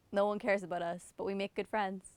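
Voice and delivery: ordinary delivery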